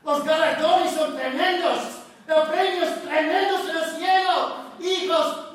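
alta y fervorosa, muy fuerte; madre exhortando. Retold or imitated. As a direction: exhorta con entusiasmo a los hijos. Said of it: "volver a la tierra y decir". (madre desde el cielo exhorta a sus hijos, fuerte y emocionada)

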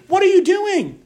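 high-pitched, indignant demand for an answer; acted-out shocked reproach; righteous indignation. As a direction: challenge them in mock outrage, pitched well up. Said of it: "He doesn't respond in righteous indignation".

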